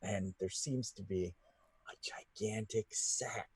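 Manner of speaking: soft hushed storytelling; whispered narration. A narrator's reading. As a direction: lean in and whisper the reveal. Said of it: "narration drops to whisper".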